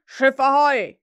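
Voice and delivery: loud hail across the water; Hook's call again. Acted. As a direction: bellow it to the boat. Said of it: "ertönte noch einmal derselbe Ruf".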